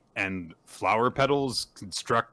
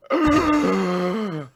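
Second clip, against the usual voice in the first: long high dramatic gasp; mock gasp; 6 semitones higher, 3 dB louder than usual. Don reacts to sister's remark, exaggerated high gasp. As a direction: gasp hugely, drawn out and hammed-up.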